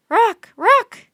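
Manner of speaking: very high, loud croaking call; loud raven croak. A narrator's reading. Raven insisting on its name, very high loud croaks. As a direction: caw the name out like a bird. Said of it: "croaked the raven".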